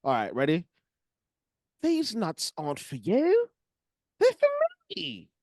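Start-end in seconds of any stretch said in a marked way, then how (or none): 1.8-5.3 a much higher, slow RP attempt that slips into Cockney; attempted posh RP accent. Friend tries a posh RP accent as a game, still Cockney. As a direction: reach for posh received pronunciation and squeak up at the end. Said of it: "the R P accent".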